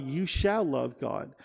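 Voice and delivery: normal talking voice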